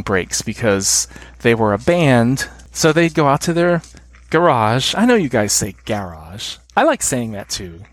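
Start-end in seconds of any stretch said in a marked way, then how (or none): 4.3-4.9 garage said guh-RAHZH, stressed on the second syllable; American garage pronunciation. Shown as a demonstration. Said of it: "with this emphasis on the latter syllable". 5.8-6.6 garage said GA-rahzh, stressed on the first syllable; British garage pronunciation. Shown as a demonstration. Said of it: "what I've heard a lot of Brits say".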